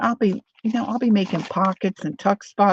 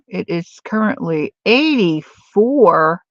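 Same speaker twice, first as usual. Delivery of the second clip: usual speaking voice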